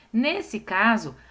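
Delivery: ordinary talk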